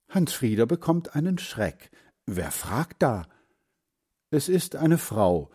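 ordinary delivery

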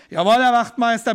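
loud barked military answer; shouted reply. Retold or imitated. Prisoner shouts his reply to the guard, loud. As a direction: snap it back like a soldier. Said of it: "brülle ich ebenso".

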